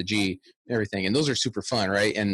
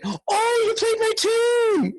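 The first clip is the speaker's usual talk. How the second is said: much higher, slow, gushing judge voice; delighted judge; 4 dB louder, much slower than usual. Retold or imitated. Judge thrilled that the piper played her tune, much higher delighted squeal. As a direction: squeal it with joy like a thrilled fan. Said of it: "She's like".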